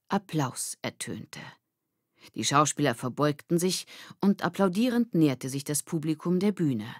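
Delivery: ordinary delivery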